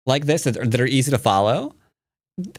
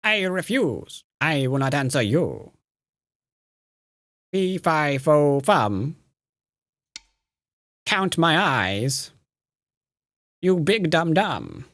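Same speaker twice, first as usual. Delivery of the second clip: mustache twirling villain; 3 semitones higher, slower than usual. Acted. Villain taunts, chat likes voices.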